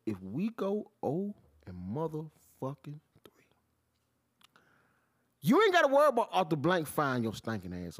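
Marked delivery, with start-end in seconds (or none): 0.1-3.5 whispered slow threat; low whispered threat to the coach; menacing whisper. Real, not acted. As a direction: hush it like a warning.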